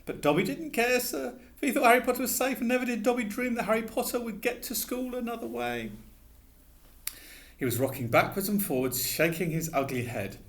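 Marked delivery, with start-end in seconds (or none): 0.1-5.9 high squeaky elf voice, anxious; a fretful little elf begging; pleading house elf. A narrator's reading. Dobby explaining.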